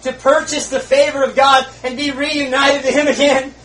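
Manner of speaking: loud, high fervent preaching of a lament; preaching at full force. Acted. Preacher voicing a dying sinner's despair, loud and high. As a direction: cry it out to the congregation.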